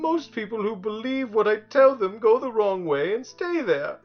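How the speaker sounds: high, loud tearful tantrum; hysterical crying. A narrator's reading. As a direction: wail like a crybaby demon. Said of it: "And crying hysterically".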